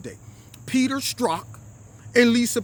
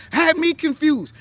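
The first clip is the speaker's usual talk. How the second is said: exclaimed disbelief; 10 semitones higher, 7 dB louder than usual. Real, not acted. Punchline of the convincing lie.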